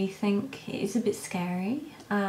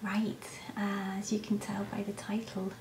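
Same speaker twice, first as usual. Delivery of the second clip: whispering over a crib; 7 dB quieter than usual. Real, not acted. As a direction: keep it to a soft whisper. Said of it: "I am talking quietly because my son's asleep".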